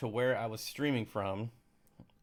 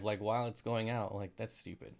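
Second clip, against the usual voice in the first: normal talking voice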